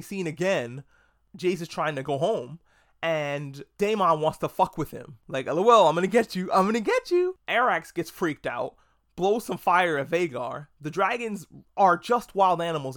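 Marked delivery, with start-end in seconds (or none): 5.5-7.4 loud taunt climbing much higher on the repeat; mocking taunt, building. Rider taunting Jace in the storm, sing-song mocking taunt rising higher. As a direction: tease like a bully chasing a kid, rising to a squeal.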